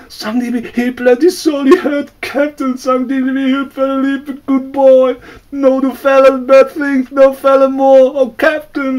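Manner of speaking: high-pitched, loud, desperate begging; a terrified servant screaming for mercy; frantic pleading. A narrator's reading. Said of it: "in his pidgin for mercy".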